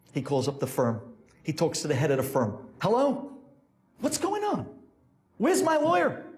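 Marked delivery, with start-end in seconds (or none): 2.8-6.2 high, urgent phone questions; acted panicked client; frantic demand. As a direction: demand answers in a raised pitch. Desperate client phones his firm.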